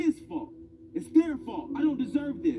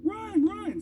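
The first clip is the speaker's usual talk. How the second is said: high excited calls to run; teammates cheering; 13 semitones higher, much slower than usual. Retold or imitated. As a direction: cheer him around the bases. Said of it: "my teammates are like".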